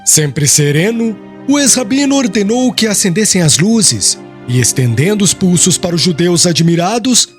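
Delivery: usual speaking voice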